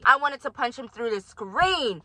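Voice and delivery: usual speaking voice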